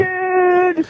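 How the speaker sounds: high, strained, whining preachy rant; mocking high-pitched motivational tirade; Bobby Kelly impression. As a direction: hammer every "dude" in a high drawn-out wail. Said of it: "that Norton's Bobby Kelly sounds like Harold Letterman".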